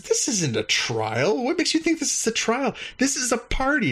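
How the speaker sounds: high, squeaky cheerful alien voice; alien host. Retold or imitated. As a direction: pitch up as a chirpy alien host.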